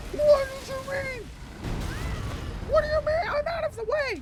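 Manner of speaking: high cartoon voice; squeaky voice. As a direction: squeak in mock panic. Squeaky panic voice.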